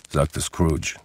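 ordinary delivery, nothing marked

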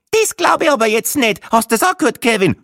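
high, loud indignant squawk; be scandalised and call on your husband; outraged Gruberin. Acted. Gruberin appeals to her husband, high and outraged.

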